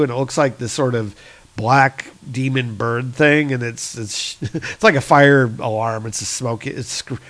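normal talking voice

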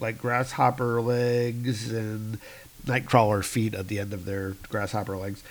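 ordinary talk

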